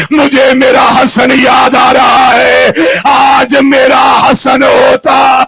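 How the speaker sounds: loud, slow grieving sermon; impassioned preaching. Real, not acted. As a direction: preach it out in full grief. Preaching the tragedy at full force. (پوری شدت سے ماتمی خطاب)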